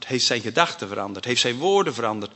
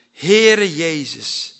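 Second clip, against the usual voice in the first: slow, louder calling out the name; loud cry; 5 semitones higher, 8 dB louder, much slower than usual. Acted. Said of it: "riep hij met luide stem". (met luide stem roepend)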